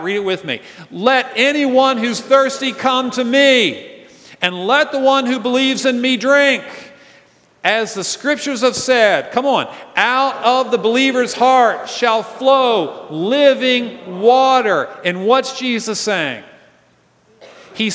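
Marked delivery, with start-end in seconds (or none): 0.9-4.1 slow, loud cry, pitched well up; Jesus crying out. Acted. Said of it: "he cried out". 4.4-14.9 loud, slow proclamation, higher and louder; Jesus crying out. Acted. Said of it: "he cried out".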